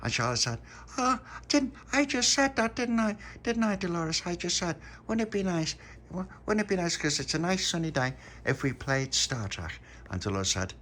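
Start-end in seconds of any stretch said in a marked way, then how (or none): 1.0-9.8 put-on very high girl's voice; a much higher, insistent girl; Charlotte's voice. A narrator's reading. Charlotte reminds the puppy she suggested Star Trek, very high.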